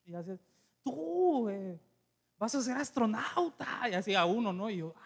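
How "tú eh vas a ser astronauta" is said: drawn-out prophetic mimicry; imitating praying prophet. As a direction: declare it slowly, mimicking the preacher. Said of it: "él iba orando y".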